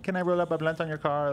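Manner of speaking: high-pitched mock Korean accent; little Korean girl. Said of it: "from a little Korean girl".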